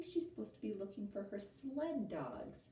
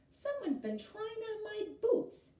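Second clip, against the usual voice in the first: higher, louder playful boom; booming big bear voice; 4 dB louder than usual. A narrator's reading. Papa Bear finding his boots moved, playful boom. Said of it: "he boomed in his big bear voice".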